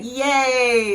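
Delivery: high, loud, stretched "yay"; drawn-out cheer. Shown as a demonstration. Teacher giving yay as a fun example, cheering. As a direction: cheer the word, holding it long.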